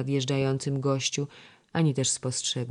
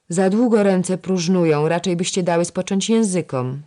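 louder, higher angry scolding; 5 semitones higher, 8 dB louder than usual. A narrator's reading. Senior lady-in-waiting scolding chattering girls at their needlework, angry. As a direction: scold the idle girls sharply. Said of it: "rzekła z gniewem".

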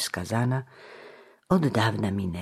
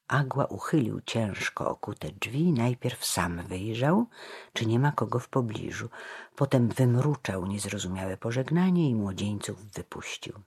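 usual speaking voice

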